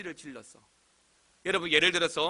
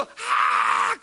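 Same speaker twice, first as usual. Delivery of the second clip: airy, drawn-out imitated shriek; mock fan scream; 3 dB louder than usual. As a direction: scream to be noticed by an idol. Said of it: "남들보다 더 크게 소리 지르는 거예요". (팬이 소리 지르는 흉내)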